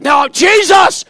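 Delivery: high, loud, drawn-out mock scream; imitated player's cry. Leader mimicking a player who lost, theatrical scream. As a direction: cry out in hammed-up anguish. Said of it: "he's like".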